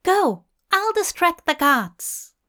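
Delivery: high, urgent female voice; female bird character. A narrator's reading. Bird urges Hop onward.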